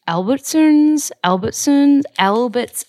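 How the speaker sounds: Albertsons tried in a high, slow, drawn-out American accent; failed American accent. Shown as a demonstration. Trying to say the store name Albertsons in an American accent. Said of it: "I can't do it with an American accent".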